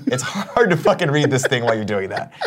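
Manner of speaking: usual speaking voice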